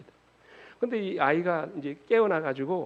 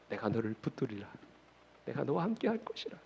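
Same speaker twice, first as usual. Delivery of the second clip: much softer, reassuring declaration; hushed divine promise; 3 semitones lower, 8 dB quieter than usual. Acted. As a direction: drop the volume and promise it gently. Promise softens after loud proclamation.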